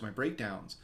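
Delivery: ordinary talk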